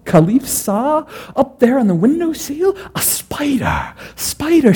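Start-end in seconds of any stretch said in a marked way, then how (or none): 4.2-4.7 high, excited cry. Acted. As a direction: burst out with the idea. Caliph delighted choosing spider.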